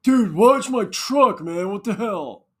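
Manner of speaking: high, loud, slow jeering dude voice; loud mock bro yell; mocking the jackass. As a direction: sneer it like the rude truck guy. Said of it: "this jackass goes".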